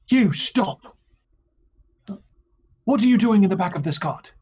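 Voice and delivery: high, loud guard's challenge; guard shouting. Acted. Startled guard yells at stranger in the cart, loud and high. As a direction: bark the order at the intruder like a startled guard. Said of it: "one of them says".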